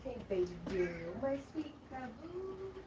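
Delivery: high, soft cooing voice. Real, not acted. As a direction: coo sweetly at the cat. Talking sweetly to pet cat.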